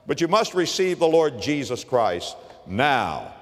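usual speaking voice

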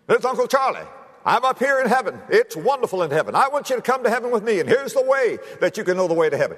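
a bright recorded greeting from heaven; Uncle Charlie on tape. Retold or imitated. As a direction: play the cheerful preacher on the recording from heaven. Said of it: "And it said".

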